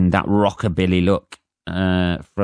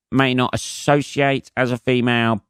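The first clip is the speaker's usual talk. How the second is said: ordinary talk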